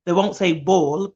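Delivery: normal talking voice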